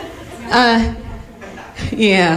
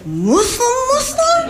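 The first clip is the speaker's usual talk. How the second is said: mock questioner; slower than usual. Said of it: "And people are like".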